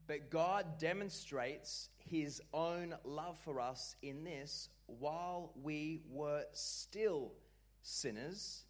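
slow, weighty reading, word by word; solemn, deliberate scripture read. Shown as a demonstration. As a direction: draw each phrase out slowly. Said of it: "Everyone say".

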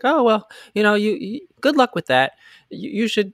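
high put-on girly brush-off; awkward girl voice. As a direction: fob him off in a high flustered voice. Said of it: "And she's like".

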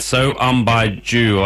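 So Um Baijo said slowly and carefully; slow, careful Portuguese title; careful pronunciation. Shown as a demonstration. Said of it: "practice my Portuguese".

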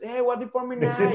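made-up English gibberish; playful mock English phrase; fake English. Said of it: "hablando inglés inventado".

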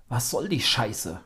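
slow, loud angry growl. A narrator's reading. Vincent snarls at Timo over the pulled blanket, slow and loud. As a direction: growl the words out slowly. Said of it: "knurrte Vincent in seine Richtung".

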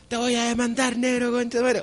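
shouted insult from a neighbor, pitched high; a neighbor's mock yell from the street; mock angry yell. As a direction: yell at him like a fed-up neighbor. Neighbors yelling at Negro Piñera.

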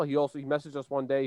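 ordinary delivery

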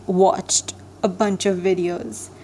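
ordinary talk

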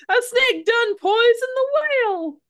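high, slow rambling oddball voice; homeless guy voice. Retold or imitated. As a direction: quote him like a crazed drifter. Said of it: "the homeless guy".

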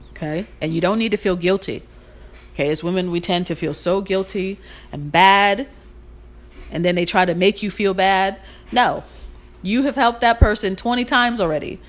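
ordinary delivery

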